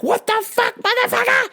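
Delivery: very high, strained yell; furious shriek. Retold or imitated. Driver swerving past Jaguar, furious shriek. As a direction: scream at the other driver. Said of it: "as I'm like".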